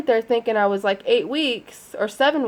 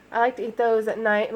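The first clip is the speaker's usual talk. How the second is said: ordinary talk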